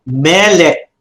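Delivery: "melek" with a long stressed ME, falling on lek; first-syllable stress. Shown as a demonstration. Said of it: "your pronunciation now would be melek".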